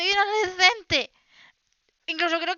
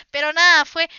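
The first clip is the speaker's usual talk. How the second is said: ordinary talk, nothing marked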